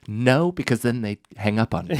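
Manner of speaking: ice-cool imitation, quickly tossed off; imitated calm. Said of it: "return to calm right there".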